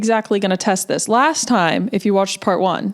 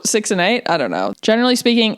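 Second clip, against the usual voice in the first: ordinary delivery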